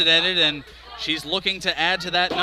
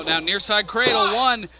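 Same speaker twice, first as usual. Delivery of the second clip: rising, louder with each count; excited count; 5 semitones higher, 6 dB louder than usual. Real, not acted. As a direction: build the count up high. Live call of a cradle pin.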